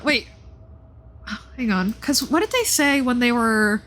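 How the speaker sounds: ordinary delivery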